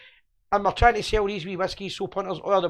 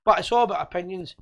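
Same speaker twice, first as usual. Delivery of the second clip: usual speaking voice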